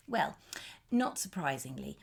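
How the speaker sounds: ordinary delivery, nothing marked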